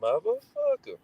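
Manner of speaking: high squeaky mock of the cuss; high mocking imitation. Sister quoting the brother's cuss to dad. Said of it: "He said".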